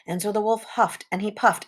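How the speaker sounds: ordinary delivery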